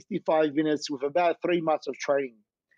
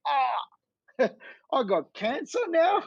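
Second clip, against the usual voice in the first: rueful dismay; 9 semitones higher than usual. Retold or imitated. Retold dismay, with a laugh.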